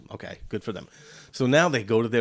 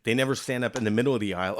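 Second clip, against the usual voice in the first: usual speaking voice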